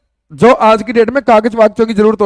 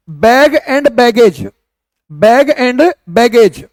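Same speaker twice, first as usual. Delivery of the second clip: slow dictation; much slower than usual. Shown as a demonstration. Teacher dictating a phrase slowly.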